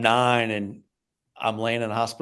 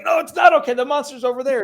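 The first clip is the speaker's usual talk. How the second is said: shouted much higher and louder, in alarm; mock horror shout; 13 semitones higher, 7 dB louder than usual. Horror audience realizing the monster is there, shouting in fear. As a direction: yell the warning like a terrified audience. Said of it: "But then it's like".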